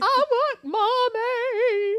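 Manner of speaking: put-on high, loud, very slow whining kid; mock crying kid; whiny child. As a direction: whine it like a crying toddler. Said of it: "the kids were like".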